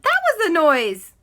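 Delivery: high, loud exclamation; startled recognition. Acted. Andy spotting the noisy cat, excited exclamation. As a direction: burst out in sudden recognition.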